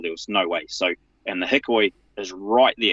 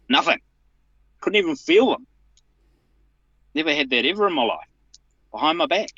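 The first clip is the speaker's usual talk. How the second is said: exasperated; 8 semitones higher, faster than usual. Real, not acted. Recalling painfully tight handcuffs.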